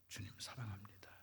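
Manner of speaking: soft whispered confession; whispered reply. Retold or imitated. Peter answering Jesus, whispered. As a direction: breathe the answer.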